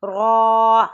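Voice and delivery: را said loud and thick; isolated ra read thick; thick heavy ra. Shown as a demonstration. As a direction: give را a full heavy sound. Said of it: "اسے موٹا پڑھیں گے".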